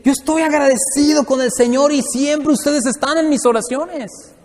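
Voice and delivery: slow, high voicing of the apostle Paul. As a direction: speak as grateful Paul. Parafrasea a Pablo. (voz de Pablo, lenta y agradecida)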